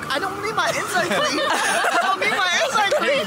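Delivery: high, laughing complaint; laughing protest. Real, not acted. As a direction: whine it through laughter.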